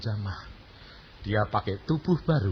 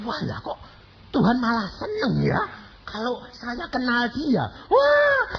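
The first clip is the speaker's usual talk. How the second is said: joyful exclamation; 10 semitones higher than usual. Retold or imitated. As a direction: burst out with delight. Joyful realization while reading.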